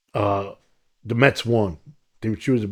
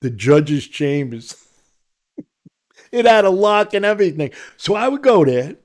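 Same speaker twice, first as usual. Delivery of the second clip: ordinary talk, nothing marked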